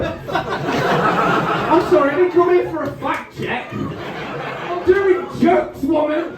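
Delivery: high, loud, slow and laughing; laughing mock outrage. Real, not acted. Comic protesting to a heckling woman, high laughing mock outrage.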